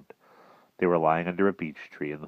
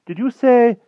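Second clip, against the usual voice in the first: high, loud puzzled question; nervous; 11 semitones higher, 11 dB louder, much slower than usual. A narrator's reading. King checks what the magician meant, nervous. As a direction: query it uneasily, pausing before the last word. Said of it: "looking at him nervously".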